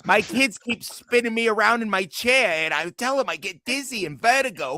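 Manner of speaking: high, put-on complaining voice; Mark Goldman. Hammed-up complaint about kids spinning the chair, mock impression. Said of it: "You sound like Mark Goldman".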